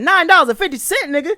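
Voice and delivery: high, loud repeat in disbelief; outraged disbelief. Retold or imitated. Customer reacting to a tiny trade-in total, outraged. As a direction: shoot it back as an incensed question. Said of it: "I was mad as shit".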